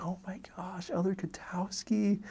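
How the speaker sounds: high, astonished stage whisper; whisper-yelling. Retold or imitated. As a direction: imitate an amazed companion yelling under his breath. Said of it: "He's, like, whispering, but, like, yelling".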